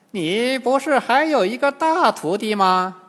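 high, loud questioning crone; old demoness. A narrator's reading. Old demoness asking the monk about his disciple, high voice. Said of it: "金蝉老妖又问唐僧".

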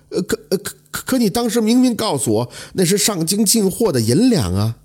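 high, stammering amazement; stunned disbelief. A narrator's reading. Scholar stunned by the shopkeeper's confession. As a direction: stammer in shock at the news. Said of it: "惊诧不已呀".

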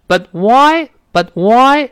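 "But why" with the t of "But" held unreleased, a tiny break before "why"; slow demo of "But why", not linked. Shown as a demonstration. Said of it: "but在这儿更像是失去爆破的声音了".

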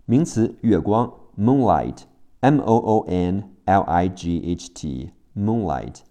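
ordinary delivery

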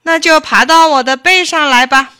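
loud, high, irritated order; angry fox. A narrator's reading. Fox orders the rabbit onto its back, angrily. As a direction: snap it out in annoyance. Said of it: "狐狸生气地说".

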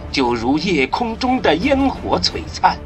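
ordinary talk

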